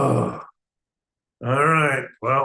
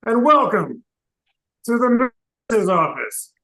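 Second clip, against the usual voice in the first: normal talking voice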